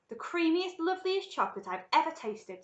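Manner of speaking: high, loud rave; delighted. Acted. Grandpa Joe raves about the chocolate he just tasted, delighted. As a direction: relish every superlative.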